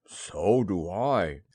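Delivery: low, slow bear voice; drawn-out low storybook bear; Pooh. Acted. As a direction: answer as a slow, simple bear. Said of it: "said Pooh".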